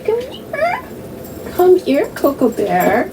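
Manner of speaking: high sing-song call for the pet; high calling to dog. Real, not acted. Calling her dog Chloe over, high coaxing voice.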